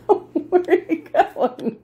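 amused, drawn out through laughter; laughing question. Real, not acted. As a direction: laugh while calling after the dog. Laughing as the dog leaves.

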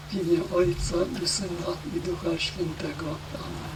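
trailing into a whisper; fading to a whisper. Real, not acted. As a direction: let the blessing die away to a whisper. Closing blessing of prayer.

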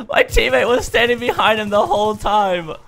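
high, loud, laughing through the words; laughing disbelief. Real, not acted. Gamer laughing at teammate's blunder, high and loud.

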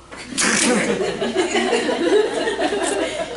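high burst of real laughter. Real, not acted. Teacher laughing at his own Coke joke, high laugh.

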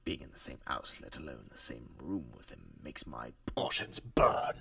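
theatrical, seething rant with loud bursts; overdramatic, hate-filled performance; worked-up hatred. Acted. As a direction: spit out the grudge, swinging between low seething and loud outbursts. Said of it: "Calm down, Mister Yugani. There's really no need to make a performance".

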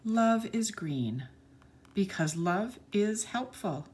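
put-on bright, sing-song read-aloud for children; lilting picture-book narration; storybook reading. A narrator's reading. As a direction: read the page like to a class of little kids.

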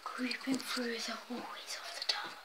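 hushed creepy narration; spooky whisper. Pretend. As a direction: whisper like sneaking through a dark hall. Spooky sneaking through hallway.

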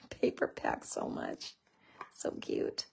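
breathy laugh through the words; laughing softly. Real, not acted. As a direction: giggle it out quietly. Laughing while praising paper.

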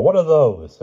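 high, loud astonished question; exclaiming in surprise. A narrator's reading. Sir Grummore asks about distant figures, exclaiming in surprise. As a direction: blurt it out, amazed. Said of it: "exclaimed Sir Grummore".

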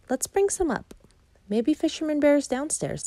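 high little cub voice; eager little cub. Acted. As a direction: pipe up like a hopeful small cub.

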